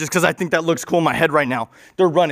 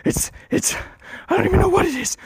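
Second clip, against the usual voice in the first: panicked, breathless stammer shooting up high; freaked out; 3 dB quieter than usual. Acted. Alien facing the giant hamster, mock panic, voice leaping high. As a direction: stammer in a hushed panic, then squeak. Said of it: "is getting freaked out".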